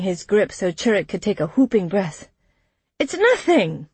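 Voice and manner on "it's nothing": high, insistent dismissal; pitched-up, pressing protest; urgent reassurance. A narrator's reading. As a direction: insist urgently that all is fine. Mayor urgently placates departing trader.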